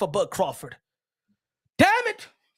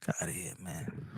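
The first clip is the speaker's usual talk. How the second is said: breathy scoff under the breath; hushed get outta here; whispered dismissal; 17 dB quieter than usual. Real, not acted. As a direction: whisper it, waving him off. Dismissive aside after rant.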